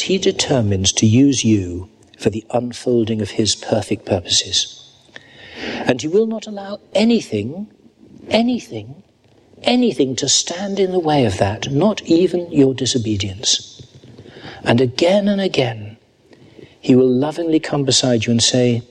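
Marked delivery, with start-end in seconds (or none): 5.9-13.8 higher, emphatic repeated "anything"; rising insistence. Real, not acted. A preacher urging the congregation, insistent and higher. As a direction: build urgency on each "anything".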